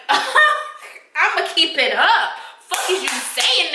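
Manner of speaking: high, drawn-out mock defiance; defiant comeback. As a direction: stretch it out high, daring the judge. Acting out defiant reply to judge.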